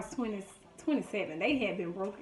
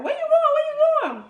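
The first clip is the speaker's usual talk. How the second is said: much higher and louder, calling after them; kids yelling; 10 dB louder, faster than usual. Retold or imitated. Cousins yelling after the sisters running home, high and loud. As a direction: shout it across the yard, high and loud.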